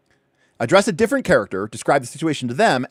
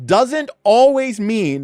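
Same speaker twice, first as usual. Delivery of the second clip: DOESN'T ALWAYS MEAN drawn out and punched high; bold-type emphasis; 8 semitones higher, 4 dB louder, much slower than usual. Shown as a demonstration. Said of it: "they put this in bold".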